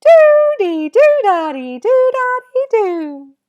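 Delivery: loud, squeaky, drawn-out rhythm chant; super high-pitched voice. Shown as a demonstration. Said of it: "with a super high-pitched voice".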